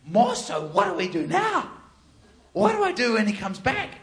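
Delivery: hammed-up worried questioning; retold panic. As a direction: act out an urgent, flustered appeal.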